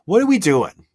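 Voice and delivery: high, pleading disbelief; exasperated outburst. Real, not acted. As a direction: cry out at the play in frustration. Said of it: "I wanna scream".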